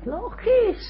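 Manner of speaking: mock congregation reply; squeaky answer. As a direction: answer for them in a high voice.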